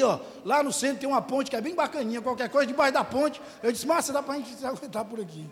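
ordinary talk

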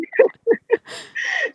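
high, helpless giggling; real laugh. Real, not acted. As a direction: burst out laughing mid-story. Laughs while telling the story.

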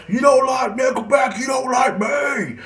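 very high, drawn-out mock scream; screaming in face. Friend walks up and screams it in his face, high mock yell. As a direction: yell it right up close as the outraged fan. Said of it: "he would just scream in my face".

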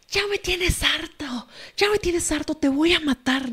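high, hushed mock scream; imitated angry yell. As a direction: act out his shouting without the volume. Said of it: "Entonces él empieza a gritar". (grito furioso imitado, agudo y contenido)

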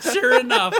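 high and loud through laughter; laughing. Real, not acted. Friend recalls finding the silly quiz, laughing loudly. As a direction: crack up while telling it. Cracking up telling quiz story.

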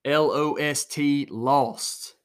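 L O S T spelled letter by letter, then lost hit firmly; spelled out for emphasis. Shown as a demonstration. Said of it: "Emphasis on that word".

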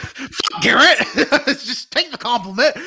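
loud, high outburst through laughter; exasperated yell breaking into laughs; laughing exasperation. Real, not acted. Friend scolding Garrett for deflecting praise, laughing exasperation.

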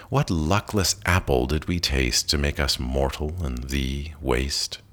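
plain reading voice

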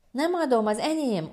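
high-pitched possessive protest; child's yell. A narrator's reading. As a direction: cry out like a toddler clinging on. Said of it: "ordította".

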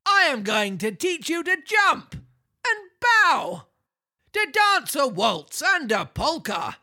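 high, slow showman voice; circus master voice. Acted. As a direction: boom it out like a ringmaster. Voices the stern donkey master.

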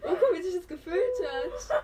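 high, loud words through laughter; laughing complaint. Real, not acted. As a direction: barely get it out, laughing loudly. Laughing at how mask felt. (laut lachend klagen)

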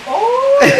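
high squeal of surprise; high squeak of shock; surprised uy. Retold or imitated. Recalling the peanut shock.

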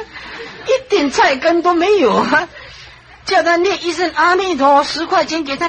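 ordinary delivery

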